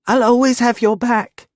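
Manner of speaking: put-on high, eager girlish voice; a devoted girl, keen to please; eager young girl voice. A narrator's reading.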